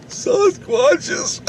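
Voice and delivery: very high, voiceless mock squeal; awestruck kid. Kid marvelling at Sasquatches up north, awestruck. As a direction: re-enact giddy amazement in a thin high voice. Awestruck child's thought, acted.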